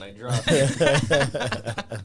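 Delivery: slow words through laughter; laughing. Real, not acted. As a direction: laugh while saying it. Joking about dropping his crown.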